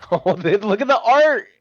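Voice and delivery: high, excited character voice; excited cartoon screech. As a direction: squeal over the artwork in a cartoon voice.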